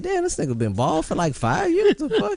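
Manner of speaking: high, incredulous rising voice; retold disbelief. Retold own shocked reaction.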